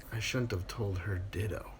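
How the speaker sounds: soft unvoiced punchline; whisper. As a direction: whisper it like a confession.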